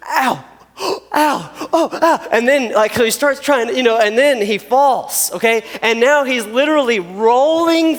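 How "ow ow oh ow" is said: higher yelps of pain, imitating the kid; mock pained cries. Pastor imitating the kid stepping on stickers, higher pained yelps. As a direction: act out the kid's pained ow ow. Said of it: "And he goes".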